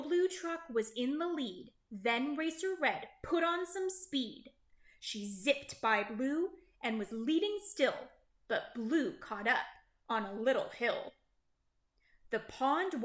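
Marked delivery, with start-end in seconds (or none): none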